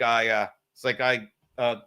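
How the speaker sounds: low-pitched, halting hunter voice; Alaskan hunter. Retold or imitated. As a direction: drop low and hesitate like a laconic guide. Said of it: "And he goes, uh".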